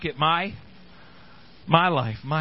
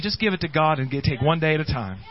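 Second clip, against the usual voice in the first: normal talking voice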